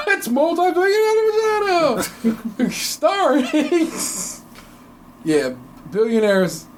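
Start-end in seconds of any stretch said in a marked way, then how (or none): 0.1-3.9 high, laughing announcer voice; game show announcer. Pretend. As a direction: hype it like a TV game show host. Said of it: "Sounds like a game show".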